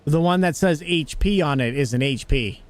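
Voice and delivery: usual speaking voice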